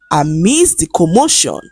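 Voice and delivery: ordinary delivery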